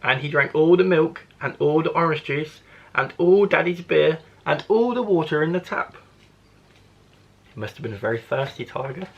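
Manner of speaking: plain reading voice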